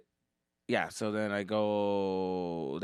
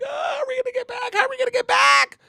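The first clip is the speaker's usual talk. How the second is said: high panicked mock scream, getting louder; scared screaming; 9 dB louder than usual. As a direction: scream it like a terrified kid. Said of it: "I'm scared".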